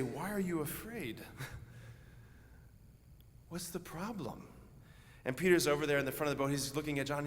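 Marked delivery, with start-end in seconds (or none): 0.0-4.5 calm, amused. Retold or imitated. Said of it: "he has the nerve to say".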